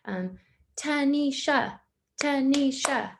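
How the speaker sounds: "Tanisha" split into three even beats; clapped syllables. Shown as a demonstration. Teacher claps a pupil's name into three beats. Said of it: "So I would say".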